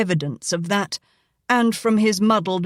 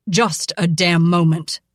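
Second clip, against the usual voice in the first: barked; 2 semitones lower, 4 dB louder than usual. Acted. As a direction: cut him off with rising rage. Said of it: "Cole barked".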